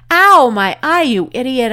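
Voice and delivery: high, loud outburst easing off slightly; storyteller's hurt, angry yell; pained yell. A narrator's reading. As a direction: yelp it, then scold. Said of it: "I yelled".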